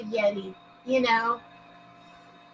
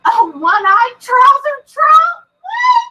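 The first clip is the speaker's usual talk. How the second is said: high, loud, slow shocked yelp; 9 semitones higher, 7 dB louder, slower than usual. Real, not acted. Streamer reacting to a viewer's rude joke, shrieking. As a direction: shriek it in scandalised disbelief.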